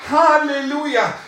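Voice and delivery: loud, high exultant Halleluja; joyful shout. Real, not acted. Preacher praising God before the congregation, exultant. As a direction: burst out in praise.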